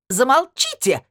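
high shrill shriek. Acted. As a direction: shriek it to shut them up. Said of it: "взвизгнула та".

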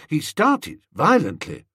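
plain reading voice

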